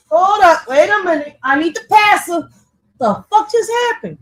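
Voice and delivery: high, loud, rattled exclamations; freaked-out yelps after tripping over words; startled outburst. Real, not acted. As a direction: blurt it out, spooked and confused. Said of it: "I just got possessed for a second".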